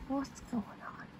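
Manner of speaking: whisper. Real, not acted. Parent whispering to the baby.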